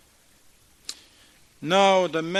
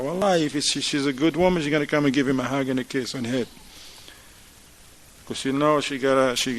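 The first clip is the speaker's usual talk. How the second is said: normal talking voice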